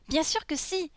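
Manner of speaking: put-on high little-girl voice, sure of herself; insistent little girl. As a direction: insist as a small child heroine. Lolisa insists to Boris.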